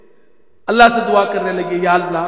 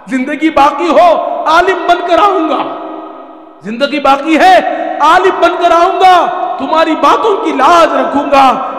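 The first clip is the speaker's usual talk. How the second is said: loud, high farewell cried out; tearful farewell call; 5 semitones higher, 6 dB louder than usual. Acted. Son bidding his unseen mother goodbye through the door, loud and high.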